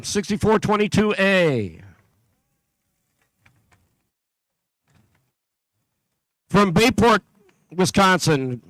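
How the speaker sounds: steady, carrying PA announcer voice; announcer read. Acted. Announcer calling out teams to the competition crowd, steady and carrying. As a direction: call out each town and team number to the hall.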